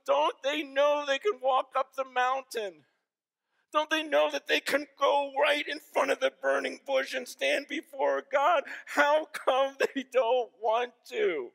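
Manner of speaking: high, loud, slow, tearful questions; retelling a tearful plea. Retold or imitated. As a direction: beg them to see it, weeping. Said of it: "And he's crying".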